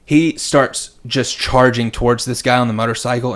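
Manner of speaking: ordinary talk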